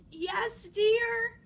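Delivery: wolf posing as granny; scratchy voice. A narrator's reading. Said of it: "came a scratchy voice from the bedroom".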